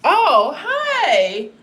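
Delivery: a sudden, loud, high "oh, hi!"; startled cheerful hello; surprised loud greeting. Real, not acted. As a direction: caught off guard, call out hello. Suddenly greets someone joining live.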